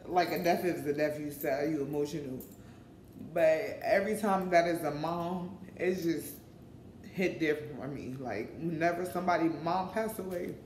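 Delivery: usual speaking voice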